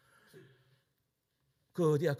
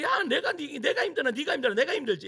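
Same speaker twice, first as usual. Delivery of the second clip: loud, high mock outburst, half laughing; hammed-up shout of a husband who won't fetch it; grumpy husband refusing; 13 semitones higher, 8 dB louder than usual. Husband snapping at his wife over the remote, loud mock outburst. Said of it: "이러면서 안 갖다 준다라는 거지".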